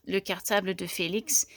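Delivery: ordinary delivery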